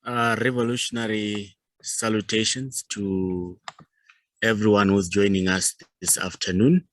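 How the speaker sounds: normal talking voice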